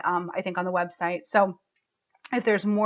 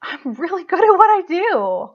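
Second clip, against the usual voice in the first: higher, louder triumphant declaration; proud; 9 semitones higher, 8 dB louder than usual. Real, not acted. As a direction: announce it with beaming pride. Proud affirmation, voice rising.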